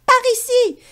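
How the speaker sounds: high, loud chirped call; chirping bird call. Acted. Sacha calls the hunters, high and loud. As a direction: cheep it like a bird. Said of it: "piaille Sacha".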